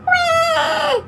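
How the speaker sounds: high wail; child wailing. A narrator's reading. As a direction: cry like a small child. Anna crying over doll.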